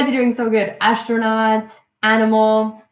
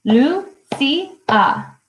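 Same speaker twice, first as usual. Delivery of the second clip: Lucia in three held beats, Lu... ci... a; syllable by syllable; 5 semitones higher than usual. Shown as a demonstration. Teacher helping the class count syllables, syllable by syllable. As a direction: split Lucia up, starting high and stepping down. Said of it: "Two syllables".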